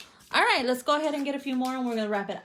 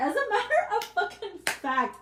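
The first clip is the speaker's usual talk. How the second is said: higher, jumping in excitedly; sudden excitement; 10 semitones higher than usual. Real, not acted. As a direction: cut yourself off, thrilled to remember. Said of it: "sudden remembering".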